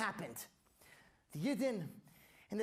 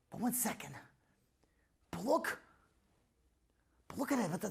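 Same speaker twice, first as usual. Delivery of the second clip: hushed whisper; 5 semitones lower, 7 dB quieter than usual. Acted. Hushed realization.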